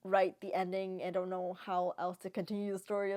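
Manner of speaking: usual speaking voice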